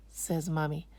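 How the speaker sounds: ordinary delivery, nothing marked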